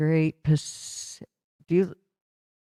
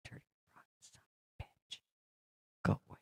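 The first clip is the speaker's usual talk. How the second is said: breathy under-the-breath swearing; a whispered grumble at the screen; whispered curse; 22 dB quieter, faster than usual. Real, not acted. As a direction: mutter the curse to yourself, almost silent. Cursing at a stuck browser tab.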